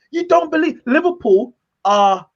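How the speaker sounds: ordinary talk, nothing marked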